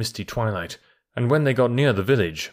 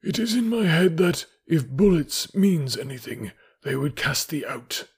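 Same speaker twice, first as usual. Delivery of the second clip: measured wolf voice, starting high and easing down; deliberate, composed animal counsellor; 6 semitones higher than usual. A narrator's reading. Akela the wolf speaks composedly.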